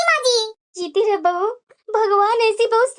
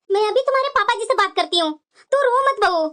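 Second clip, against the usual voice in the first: usual speaking voice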